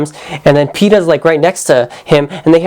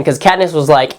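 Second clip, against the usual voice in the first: normal talking voice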